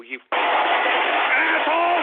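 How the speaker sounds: high, long-drawn cursing roar; furious yell. Real, not acted. Host cursing a mocking tweeter, furious yell. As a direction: explode and drag it out.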